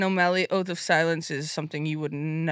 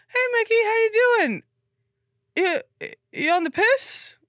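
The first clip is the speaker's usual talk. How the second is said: imitating Aaron. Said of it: "he sells it so much funnier".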